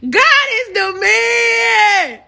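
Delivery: very high, very slow, loud and crying out; ecstatic praise cry. Real, not acted. Praising God at the height of her testimony, in a slow, high, crying shout. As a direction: stretch it out like a shout in church.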